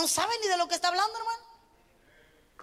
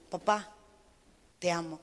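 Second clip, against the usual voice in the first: put-on child's tender words; 9 semitones lower, 3 dB quieter than usual. Child tells father he loves him.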